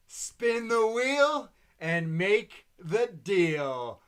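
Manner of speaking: slow, loud, high dramatic announcing; over-the-top host. Retold or imitated. Quoting the Halloween host to the crowd, slow and hammed-up. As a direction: ham it up like a spooky show host. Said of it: "And she says".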